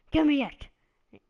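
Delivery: high, loud shouted demand; mock demand. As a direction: bellow it like a wrestler losing patience. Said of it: "And he's like".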